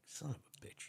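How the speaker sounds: soft, unvoiced acted curse; pissed-off grumble. Older cop stuck taking coffee orders, pissed-off grumble. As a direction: curse under the breath. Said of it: "he's all pissed off".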